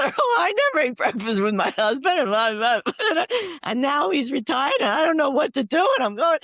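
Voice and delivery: amused mock inner monologue. Mocking her own inner thoughts.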